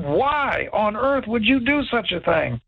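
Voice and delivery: exasperated, replayed reproach; retold disbelief. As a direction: draw out WHY in dismay. Retells scolding friend in disbelief.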